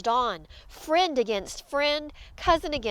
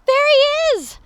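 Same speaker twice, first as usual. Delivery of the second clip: high, loud excited shouts; crowd's astonished cries; alarmed calling; 13 semitones higher, 8 dB louder than usual. Acted. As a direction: call out as you spot it overhead. Crowd spots captured dog.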